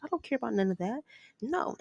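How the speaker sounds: stern refusal. Retold or imitated. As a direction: mom shutting down her daughter's begging. Mom refuses daughter's plea.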